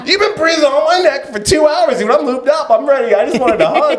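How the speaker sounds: high-pitched comic protest, half laughing; mock-indignant protest. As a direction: plead your case in a high, exaggerated retelling. Retold protest at a rejected hug.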